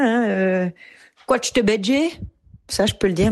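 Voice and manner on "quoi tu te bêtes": higher-pitched Swiss Jura patois jibe; Jura accent. Shown as a demonstration. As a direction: throw out an insult in Jura patois. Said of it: "en jurassien". (accent jurassien, en patois)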